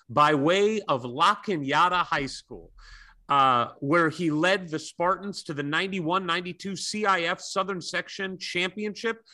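ordinary talk, nothing marked

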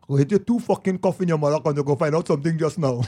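put-on Trinidadian country accent, furious. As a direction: shout it like an angry country dad from Trinidad. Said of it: "so his shit is like this".

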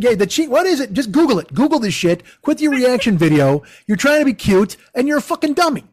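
mock irritated dressing-down; exasperated telling-off. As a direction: tell him off, fed up. Mock rant at reaction video maker.

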